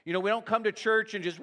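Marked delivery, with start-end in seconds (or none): 1.4-1.4 long high "woo" of a churchgoer; mock worship whoop. Preacher mimicking people who only come to church to cheer, mock whoop. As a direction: whoop it up like a carried-away worshipper.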